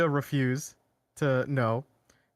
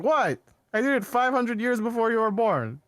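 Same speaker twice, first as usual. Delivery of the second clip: defensive Nandor; 7 semitones higher than usual. Retold or imitated. Friend retelling Nandor defending his past pillaging. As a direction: brush it off, exasperated. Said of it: "he's like".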